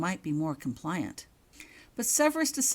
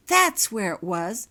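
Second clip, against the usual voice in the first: louder, slow pleased discovery; pleased realization; delighted; 4 semitones higher, 10 dB louder, slower than usual. Acted. Girl spots the portraits in the office, delighted. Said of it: "she said with pleasure".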